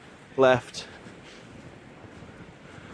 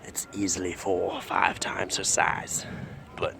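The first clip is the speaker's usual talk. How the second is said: hushed, low wildlife narration; nature documentary narrator; 12 semitones lower, 11 dB quieter than usual. Acted. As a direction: murmur like a nature film host.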